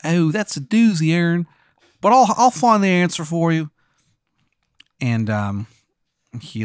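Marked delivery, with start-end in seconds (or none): 0.0-3.7 higher-pitched bad Maryland accent; Shithead Steve's bad Maryland accent. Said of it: "his Maryland accent is".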